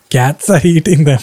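a laugh cutting into the words; nervous laughter at grim news. Real, not acted. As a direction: let the laugh break in. Laughing at the bunny story.

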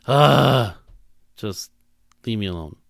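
exasperated groan. Pretend. Pretending to be worn down by pious platitudes, exasperated. Acting fed up with quips.

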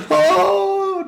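a very high squealed "Oh"; high imitated cry of first sight; mock gasp of wonder. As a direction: jump way up into a falsetto coo. She-wolf sees the baby.

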